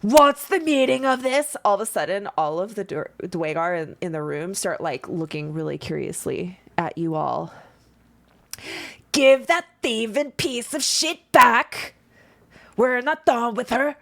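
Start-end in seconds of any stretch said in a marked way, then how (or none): 0.0-1.6 louder, higher indignant demand; outraged innkeeper bark. Acted. Gulder demands answers. 9.1-14.0 loud, higher furious shout; raging demand; angry shout. Acted.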